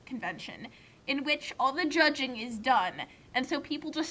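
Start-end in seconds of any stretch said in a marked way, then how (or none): 0.1-0.7 whispered aside. Real, not acted.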